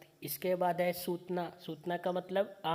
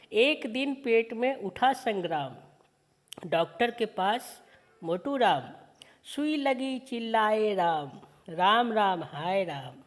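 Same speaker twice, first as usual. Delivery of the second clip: slow, sing-song poem reading; poem recitation; 2 semitones higher, 4 dB louder, slower than usual. Acted. Teacher reciting a children's poem slowly to students. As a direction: recite each line slowly for the class.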